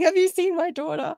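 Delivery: high-pitched retold desperate question; frantic plea. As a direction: imitate a panicked parent stopping a stranger. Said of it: "running in front of him in the road going".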